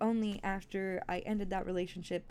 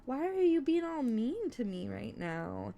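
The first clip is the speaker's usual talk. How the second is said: exasperated retelling; 9 semitones higher, 4 dB louder than usual. Retold or imitated. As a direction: replay the outrage at her. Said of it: "I was like, "Girl".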